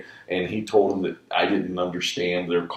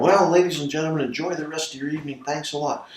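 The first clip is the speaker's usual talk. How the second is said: rushed sign-off; 9 semitones higher, 3 dB louder, faster than usual. Retold or imitated. Quitting the show mid-set.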